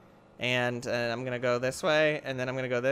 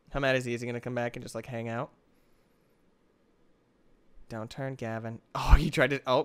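normal talking voice

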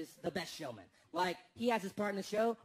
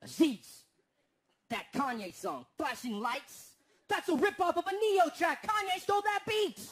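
indignant cousin; 7 semitones higher, 5 dB louder than usual. Retold or imitated. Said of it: "He's like".

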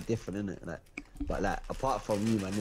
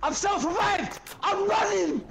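panicked, high-pitched shouts; frantic high yelling. Real, not acted. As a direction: yelp it out as you're being chased.

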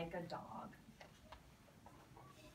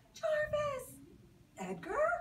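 very high, loud glad cry; joyful reunion call; 8 dB louder, slower than usual. Retold or imitated. Reunion cries between characters.

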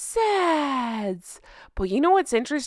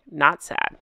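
much lower deadpan punchline, "Not sad"; 8 semitones lower than usual. Pretend. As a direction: drop the pitch and say "Not sad" flatly, voiced. Punchline after true story.

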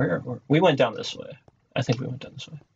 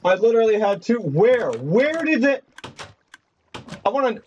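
outraged at losing the loot; exasperated; 9 semitones higher than usual. Real, not acted. Lost items, outraged.

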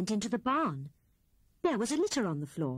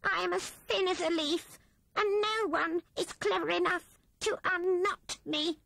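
pleading Rat; 11 semitones higher than usual. A narrator's reading. Rat begging Owl to unknot his tail, pleading. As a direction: beg with folded paws. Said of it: "in a supplicating way".

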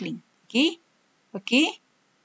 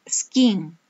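whispered drill word. Shown as a demonstration. Teacher modelling skiing for pupils, whispered. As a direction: breathe the word skiing without voice. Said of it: "teacher drilling vocabulary word".

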